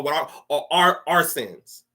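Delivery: ordinary delivery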